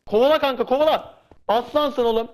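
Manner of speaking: high, loud cheer for a teammate; a gamer urges his buddy to chase the enemy, cheering high and loud; excited cheering. Real, not acted. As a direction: egg him on at full pitch.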